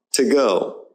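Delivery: "to go" as tuh GO; weak to. Shown as a demonstration. Teacher giving an example, "to go" with to as tuh. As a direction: unstress "to" before "go". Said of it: "but it's pronounced to".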